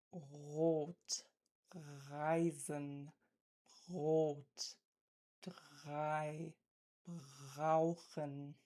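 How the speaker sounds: Rot, Reisen, Brot, drei, brauchen with a throaty rasped R; R in Rot, Reisen, Brot, drei, brauchen scraped at the uvula; uvular fricative r. Shown as a demonstration. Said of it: "examples using the uvular fricative".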